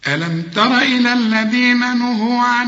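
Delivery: high, loud chanted Quran recitation. Acted. Reciter chanting the verse after the lesson. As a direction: chant the verse in full tajweed.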